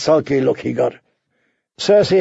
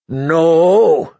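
ordinary delivery, nothing marked

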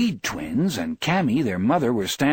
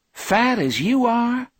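high, drawn-out teasing question; needling disbelief; 13 semitones higher, 3 dB louder, slower than usual. A narrator's reading. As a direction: needle the husband slowly, pitched way up. Wife needling her husband.

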